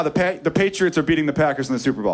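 normal talking voice

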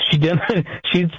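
ordinary delivery

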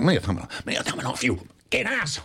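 imitating him, dropping to a hushed hiss then jumping high; half-played threat, hushed then suddenly high; mock menacing Dustin. Retelling Dustin flicking their shoulders, mock menacing. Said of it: "Like this".

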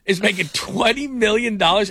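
exaggerated "can you believe it" amazement; mock astonishment. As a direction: react as if stunned by the figure. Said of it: "we all just went".

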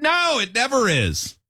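drawn-out, high-pitched firm correction; firm parental no. Retold or imitated. Retold firm correction of child.

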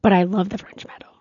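a secret confession, whispered; hushed whisper of fondness; whispered aside. Real, not acted. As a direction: whisper it under the talk. Hushed aside about restaurant.

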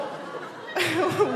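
high laugh before going on. Real, not acted. Storyteller about to explain her divorce, laughing. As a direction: let out a real high laugh.

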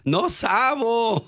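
burla aguda y lenta de un niño; imítalo riendo, estirando No sabo; niño imitado. Retold or imitated. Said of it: "Y que le diga". (se burla del niño que no habla español, voz aguda)

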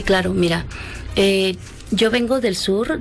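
ordinary talk, nothing marked

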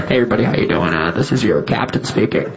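low, laid-back pilot announcement over the intercom; airline captain voice. Retold or imitated. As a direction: play the calm captain on the PA. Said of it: "the captain came on and he was like".

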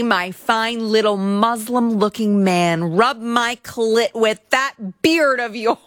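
lightly voiced crude demand; mock lewd order. Reading the complaint, a mocking Hajini orders him. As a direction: ham up a lewd command. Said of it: "ordering him to".

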